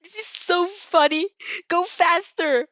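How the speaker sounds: high, drawn-out gleeful shouting; slow, loud, high squeals of fun; laughing delight. A narrator's reading. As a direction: cheer them on, thrilled. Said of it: "she laughs".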